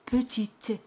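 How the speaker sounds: petite ending on the T sound, no vowel after it; silent final E. Shown as a demonstration. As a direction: say petite with the last e mute. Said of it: "E al final no se pronuncia".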